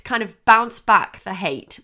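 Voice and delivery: usual speaking voice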